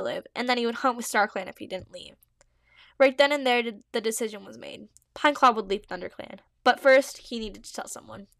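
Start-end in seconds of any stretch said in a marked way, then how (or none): none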